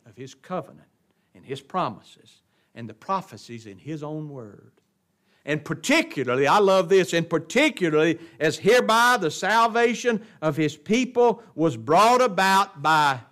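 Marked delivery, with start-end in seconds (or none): 5.5-13.2 loud, high-pitched pulpit proclamation; forceful preaching. Real, not acted. Pastor proclaiming Christ's suffering to his congregation, forceful preaching. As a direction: preach it out with weighty, punched words.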